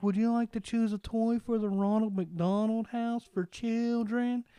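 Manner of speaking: high, slow put-on cashier voice; slow, high store-clerk sales pitch; cashier impression. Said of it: "I'm really good at impression".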